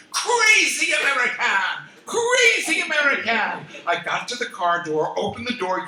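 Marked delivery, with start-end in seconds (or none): 0.1-3.6 high, loud angry yelling with a laugh; screaming. Retold or imitated. Cheesemonger chasing the tourist, screaming angrily. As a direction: yell it after the fleeing customer. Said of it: "ran after me screaming".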